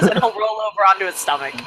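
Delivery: normal talking voice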